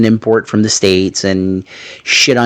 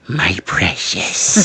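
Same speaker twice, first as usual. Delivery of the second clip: lower, soft and slow Gollum croon; 5 semitones lower, 4 dB quieter, much slower than usual. Retold or imitated. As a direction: hiss it like Gollum, slowly.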